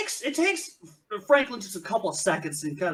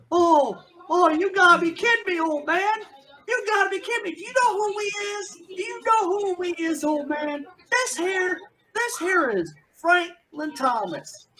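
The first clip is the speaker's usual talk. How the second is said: taunting outlaw; 11 semitones higher than usual. Acted. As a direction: sneer at the old man with a backwoods swagger. GM voices outlaw taunting old man.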